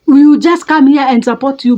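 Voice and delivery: normal talking voice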